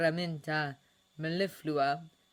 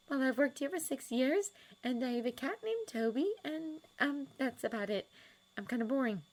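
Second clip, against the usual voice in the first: put-on higher, lighter voice for a shy young woman; Miss Hooper voice; 9 semitones higher than usual. A narrator's reading. Miss Hooper to Sirius, pitched up and hesitant.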